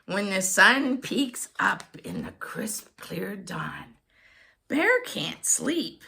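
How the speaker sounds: ordinary delivery, nothing marked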